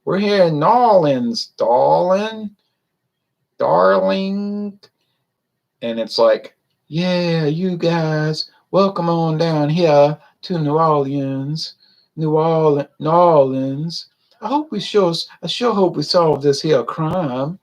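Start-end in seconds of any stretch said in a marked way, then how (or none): 0.0-4.8 high, drawn-out mock New Orleans accent; fake TV New Orleans accent. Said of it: "trying to mimic a New Orleans accent". 6.9-17.6 slow, higher mock Southern New Orleans accent; fake TV New Orleans accent. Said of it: "trying to mimic a New Orleans accent".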